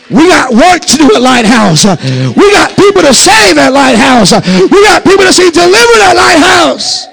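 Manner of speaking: high, loud preaching shout, voice cracking; full force preaching. Real, not acted. Pastor firing up his congregation, crying out loud. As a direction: shout it out with fervour.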